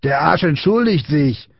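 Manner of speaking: high, drawn-out jeer; mocking announcement. A narrator's reading. Big boy to the other children, scornful jeer. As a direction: jeer to your gang. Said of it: "drehte sich ... um und lachte".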